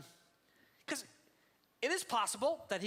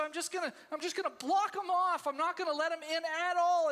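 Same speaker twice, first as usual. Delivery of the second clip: imitating a hurt person; 6 semitones higher, 4 dB louder than usual. Voicing a hurt person shutting out.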